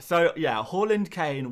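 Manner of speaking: normal talking voice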